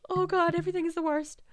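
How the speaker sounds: high-pitched whimpering apology; distressed pleading. Pretend. Player begs crying game character for forgiveness, distressed pleading.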